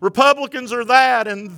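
high, loud desperate prayer to the Lord; pleading believers. Retold or imitated. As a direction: cry out to God like a worried believer. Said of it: "Some people think".

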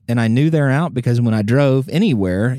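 ordinary talk

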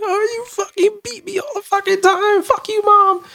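high, tearful mock outburst; resentful kid. Resentful kid cursing out mom, high mock-crying outburst. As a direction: squeal it like a kid at breaking point. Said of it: "grows up with that resentment".

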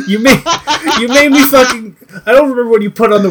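high, loud, laughing protest; laughing accusation. Real, not acted. Co-host blaming friend for an awful watch, laughing loudly. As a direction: blame a friend through laughter.

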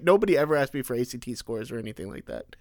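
normal talking voice

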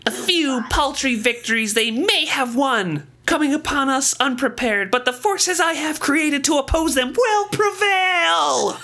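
high nasal squeaky villain voice; squeaky Palpatine voice. Retold or imitated. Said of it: "a nasally, squeaky voice".

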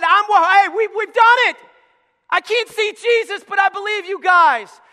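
high, loud joyful cry; excited shout. Acted. Crippled man cheering his friends, shouting. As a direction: shout the good news up. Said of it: "He said".

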